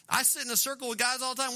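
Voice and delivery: normal talking voice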